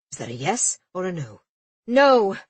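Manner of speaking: furious shout of no; angry captain. A narrator's reading. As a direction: snap the no out. Frustrated captain snaps back.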